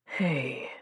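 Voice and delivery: soft, tender hey; gentle. Acted. Marcus soothing crying Mary Catherine, tender and soft. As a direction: coax her softly, barely voiced. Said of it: "came with a gentleness".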